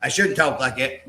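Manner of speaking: normal talking voice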